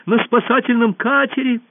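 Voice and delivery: high, loud cry; shouted news. A narrator's reading. Pursuer reporting the escape, shouting. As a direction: cry it out high to a whole hall. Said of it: "закричал он".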